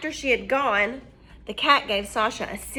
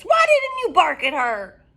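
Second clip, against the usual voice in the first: witch shout; 8 semitones higher, 6 dB louder than usual. Acted. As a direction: shout down the lazy dog. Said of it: "shouted Bony Legs".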